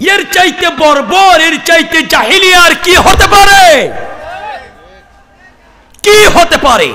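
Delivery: high, full-force cry of outrage; outraged shout. Real, not acted. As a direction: cry out high, ends held and dropping. Outcry at the barbarity just told.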